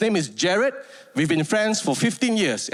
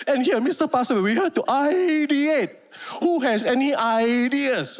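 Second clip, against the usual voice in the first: lightly mock the boss leading the meeting; hammed-up boss at the whiteboard; 6 semitones higher than usual. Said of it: "imitating the boss".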